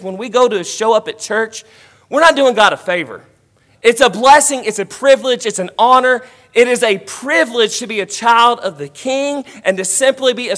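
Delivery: loud, rising preacher's cadence, stressing each line; full-force preaching. Acted. Preacher urging the church that worship is a privilege, in a loud preaching voice. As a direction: drive it home to the congregation like a revival preacher.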